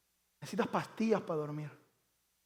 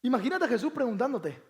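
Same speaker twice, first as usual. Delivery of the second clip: ordinary talk, nothing marked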